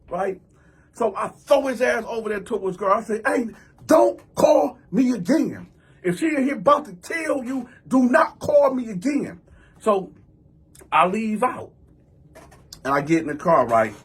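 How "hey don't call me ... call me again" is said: high, emphatic, deliberate scolding; retold stern warning. Man tells cousin never to call, emphatic high warning. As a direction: lay down the law, raised pitch, heavy beats.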